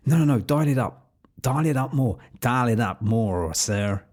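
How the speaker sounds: badly faked American accent of a pushy director; shaky amateur American accent, urging an actor on; attempted American accent. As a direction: put on a clumsy American accent, insisting. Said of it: "meant to be a American accent".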